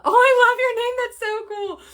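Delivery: high, excited burst of admiration; thrilled squeal at a viewer; delighted gushing. Real, not acted. Gushing over a viewer's name.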